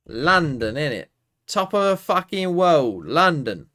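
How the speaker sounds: loud, high hype for London; proud cheer. Real, not acted. Gamer cheering London in a city quiz, loud and proud. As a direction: hype up your home city.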